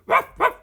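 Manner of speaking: loud high yapping barks, ruff ruff; dog barking. Acted. Reader voicing the dog Slim barking, loud high barks. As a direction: bark like a dog giving chase, loud and high.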